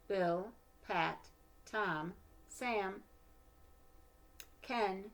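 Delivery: storybook narration, gentle and lively; reading to children. Acted. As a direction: read it like a bedtime picture book. Reads picture book aloud.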